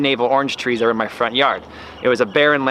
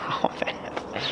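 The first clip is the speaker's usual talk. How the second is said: soft breathy whisper, no voice; whispered aside; 12 dB quieter than usual. Real, not acted. Reacting to stolen oranges.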